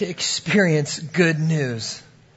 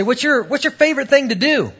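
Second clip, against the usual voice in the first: retold high-pitched coaxing question; upbeat question; 9 semitones higher than usual. Interviewer asking the applicant his favorite thing, upbeat. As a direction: lift it well up, prompting him hopefully.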